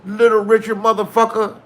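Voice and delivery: [name] yelling at the repo men. Retold or imitated. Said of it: "And he would say".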